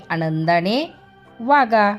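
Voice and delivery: plain reading voice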